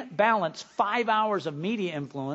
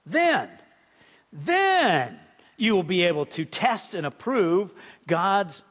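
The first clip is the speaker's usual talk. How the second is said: slow, weighty declaiming with key words punched; louder on key words, with deliberate pauses; emphatic scripture reading; 4 dB louder, slower than usual. Acted. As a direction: preach the verse, drawing out each phrase. Preacher declaims the Bible verse.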